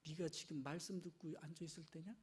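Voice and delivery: low, soft, grumbling reproach; muttered reproach. Retold or imitated. Martha grumbling at Mary, low and soft. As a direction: grumble at the sister under your breath. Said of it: "막 화가 나는 거요".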